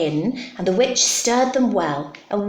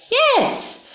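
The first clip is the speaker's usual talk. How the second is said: high-pitched cry of yes; witch's cry. Acted. Witch welcomes the bird aboard, high cry. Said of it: "cried the witch".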